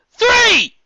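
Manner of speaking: loud, high shout of the count; yelled. Acted. Violet yells the count to the group, loud and high. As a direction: call it out to the team.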